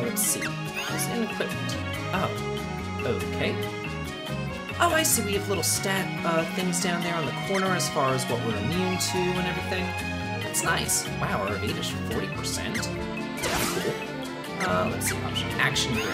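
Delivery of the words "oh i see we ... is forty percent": ordinary delivery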